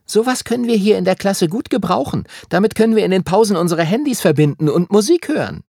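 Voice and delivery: high, quick bossy schoolgirl; Emma's voice. A narrator's reading. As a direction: voice a pushy girl, fast and high. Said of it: "erinnerte sich, was Emma gesagt hatte".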